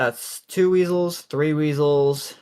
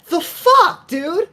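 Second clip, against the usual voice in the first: very high, loud disbelief; incredulous high-pitched outburst; yelling; 7 dB louder, much slower than usual. Acted. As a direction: yell it in exasperation.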